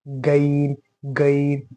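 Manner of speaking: gargle the gh of गैन; Arabic ghain. Shown as a demonstration. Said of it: "गा से भी बचाना है".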